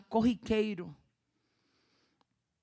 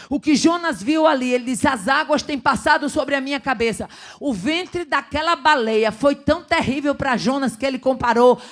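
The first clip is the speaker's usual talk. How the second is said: loud, raised proclamation of the verse; fervent scripture preaching; 4 semitones higher, 8 dB louder than usual. Acted. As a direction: proclaim Jonah's prayer to the church. Preacher reads scripture with fervour.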